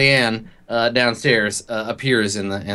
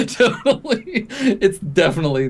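slow, much higher sarcastic "totally"; drawn-out mock agreement; 11 semitones higher than usual. Game master teasing the player about the jingle, slow and mock. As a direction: stretch it out teasingly.

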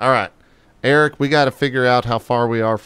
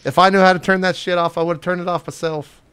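higher and louder irritated snap; exasperated order; 7 semitones higher, 3 dB louder than usual. Acted. Boss scolding Peony for her look, loud and irritated. Boss snaps at crew member.

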